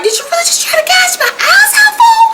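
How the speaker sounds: very high, hammed-up wail; mock crying. Roller crying to his brother over the fight, mock wail. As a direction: whine it out in fake sobs, half laughing. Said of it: "Roller start crying like".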